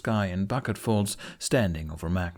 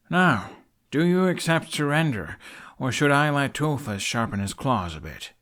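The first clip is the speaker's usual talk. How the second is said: cool threat; 5 semitones higher than usual. A narrator's reading. Hiccup threatening the trapper.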